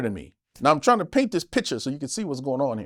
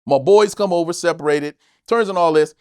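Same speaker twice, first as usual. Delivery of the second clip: ordinary delivery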